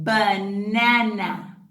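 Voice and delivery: slow, drawn-out teaching voice; slow model word. Shown as a demonstration. Teacher modelling words, very slow. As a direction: stretch each syllable for little ones. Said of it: "modelling words slowly".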